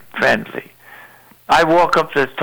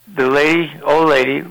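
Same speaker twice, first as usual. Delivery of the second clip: normal talking voice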